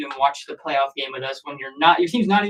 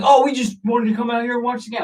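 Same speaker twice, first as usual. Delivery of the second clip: high-pitched mimicking of clueless visitors; imitated rival fans; 8 semitones higher, 4 dB louder than usual. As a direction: put on a higher voice for the other fans. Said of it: "They're like".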